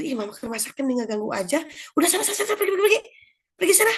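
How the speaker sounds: imitated exasperated shooing, rising high; annoyed mother. Mother sending child out of the kitchen, annoyed. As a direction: shoo the kid away, mock-irritated. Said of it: "Kebanyakan kita kesal".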